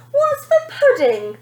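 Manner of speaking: high, loud spoilt demand; a bratty child's demand; demanding girl. A narrator's reading. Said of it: "the girl would demand".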